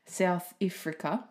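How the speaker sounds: "South Africa" in an attempted South African accent, Africa as "Ifrica". Shown as a demonstration. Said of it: "all I can do in a South African accent".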